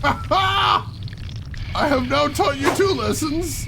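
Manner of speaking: high, loud gloating victory cry; triumphant shout. Real, not acted. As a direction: gloat loudly at the spider. Traps the spider again.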